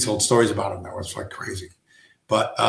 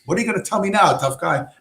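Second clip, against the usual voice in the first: higher, mock taunting cop; 7 semitones higher, faster than usual. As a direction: needle him like a smug cop. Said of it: "And he says".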